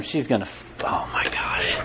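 low whispered aside; real, breathy under-the-breath reaction; hushed dismay. Real, not acted. Flustered aside breaking off mid-sentence.

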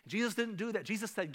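ordinary talk, nothing marked

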